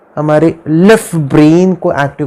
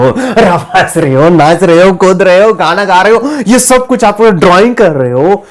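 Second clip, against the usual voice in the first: lively, higher-pitched rolling list; playful excitement; 4 semitones higher than usual. Shown as a demonstration. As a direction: bounce through it with a laugh at the start. Acts out playful, laughing subconscious mode. (चहकती, जोश भरी आवाज़)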